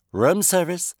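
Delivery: put-on light Canadian accent, sung a little high; perky Canadian lilt at the door; Canadian twang. A narrator's reading. McGillis announces breakfast in a Canadian twang. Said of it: "The voice had a Canadian twang".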